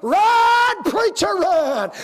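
high, loud cheering shout; crowd shouting. Retold or imitated. Crowd yelling at the preacher rounding third, loud shout. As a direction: urge the runner on. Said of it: "what they was doing".

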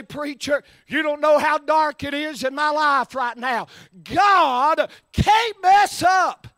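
loud, high, whooping preacher climax; full-throated sermon cry; preaching at full force. Acted. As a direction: drive it home like a revival preacher. Preaching climax, rising fervor.

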